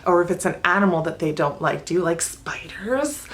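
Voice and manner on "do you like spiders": whispered spooky question; teasing whisper. Shown as a demonstration. Teacher teasing a student who dislikes spiders, whispered. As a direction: breathe it like a secret.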